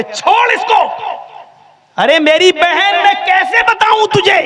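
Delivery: high, shouted plea to let go; hammed-up frantic doctor; exasperated shout. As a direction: yell in despair at the stubborn woman. Doctor exasperated pulling them apart.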